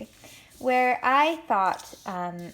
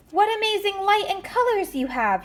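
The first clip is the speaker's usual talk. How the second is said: much higher, louder cry of wonder; amazed yell; 12 semitones higher, 4 dB louder than usual. Acted. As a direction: call out in awe. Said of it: "yelled Little Pug and Big Pug".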